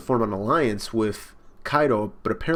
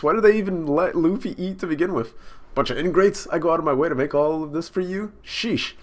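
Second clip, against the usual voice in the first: higher, louder cartoon crew voices; voicing the Straw Hats; 7 semitones higher, 4 dB louder than usual. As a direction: play the rowdy crew, bright and bickering. Reads Straw Hat crew lines.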